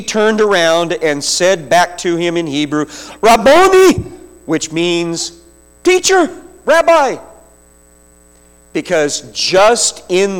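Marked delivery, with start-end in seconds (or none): 3.2-3.9 high, loud cry of recognition; joyful cry. Acted. Mary seeing risen Jesus, a high joyful cry. 5.8-7.2 much higher, a cry of recognition; joyful cry. Acted. Mary greeting her teacher, high joyful cry.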